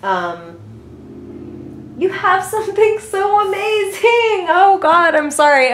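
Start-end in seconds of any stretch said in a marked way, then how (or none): 2.0-5.7 high giggly excitement; real giddy laughter while reading cards; laughing. Real, not acted. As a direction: laugh through the words, thrilled. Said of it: "I'm laughing and I sound kinda nutty".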